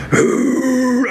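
dog growl. Retold or imitated. Imitating the big rescue dog snarling at the vet, mock growl. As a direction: bark it out like a warning dog.

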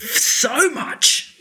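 laughing while agreeing. Real, not acted. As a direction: giggle out the words.

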